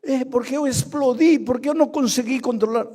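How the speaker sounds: high, loud defensive whine; a hint of a fired man's excuse. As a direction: mimic a worker excusing his outburst. Pastor mimics excuse of fired worker.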